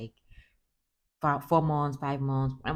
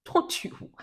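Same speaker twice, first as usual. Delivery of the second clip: exasperated plea; 11 semitones higher, 3 dB louder, much slower than usual. Retold or imitated. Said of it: "I'm like".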